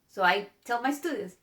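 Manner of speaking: usual speaking voice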